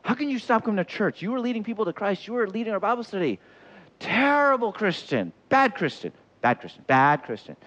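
high, hammed-up reproach; scolding. Church member shaming someone who failed, mock scolding. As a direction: scold like an indignant accuser. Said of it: "this is how you approach other people".

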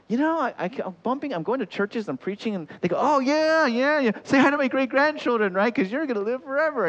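high, excited mock fan chatter; John's churchgoers gushing. Churchgoers greeting John who will live forever, gushing. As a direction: gush in a high, hammed-up voice. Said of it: "John's going through life thinking ... they go".

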